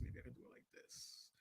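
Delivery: breathy test whisper, no voice at all; whispered mic test. Shown as a demonstration. Testing the mouth camera for viewers, whispered. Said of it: "I didn't like it as a camera on my mouth".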